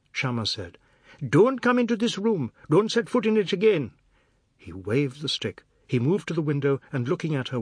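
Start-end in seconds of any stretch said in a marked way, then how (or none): 1.3-3.9 high loud terrified yell; frantic scream. A narrator's reading. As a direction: shriek to keep her away. He screams, stick raised.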